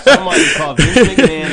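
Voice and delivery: laughing. Real, not acted. Laughing remark.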